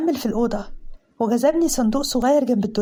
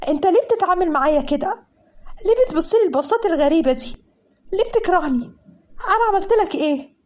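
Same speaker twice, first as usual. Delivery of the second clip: angry questioning; 6 semitones higher than usual. Acted. A woman confronting Mazen over why he hates her, angry and higher. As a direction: fire the questions back at him in anger. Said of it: "رديت عليه بنفس العصبية".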